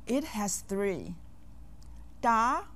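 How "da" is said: Da rising in pitch; second tone. Shown as a demonstration. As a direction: lift the pitch through a firm Da. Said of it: "with the second tone".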